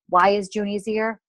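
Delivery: ordinary delivery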